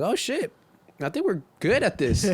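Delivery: mock wide-eyed discovery; pleasantly surprised. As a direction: act out dawning, delighted realization. Retold thrilled realization after first show.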